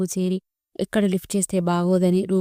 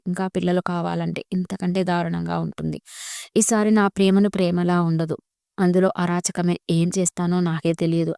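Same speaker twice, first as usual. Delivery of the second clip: ordinary delivery, nothing marked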